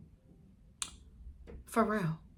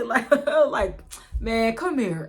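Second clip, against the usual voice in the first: laughing, louder and higher; 5 semitones higher, 8 dB louder, much slower than usual. Retold or imitated. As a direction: chuckle it out playfully. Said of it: "he started laughing like this shit was funny".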